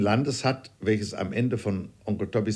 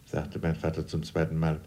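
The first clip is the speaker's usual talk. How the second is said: ordinary delivery, nothing marked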